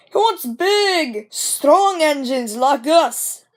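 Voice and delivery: slow, high boastful drawl; smug big engines. Acted. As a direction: lord it over the little one.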